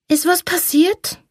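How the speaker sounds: put-on high, drowsy child's question; sleepy little girl. A narrator's reading. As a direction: ask like a just-woken child. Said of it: "Schlaftrunken kam Bibi". (verschlafenes kleines Mädchen)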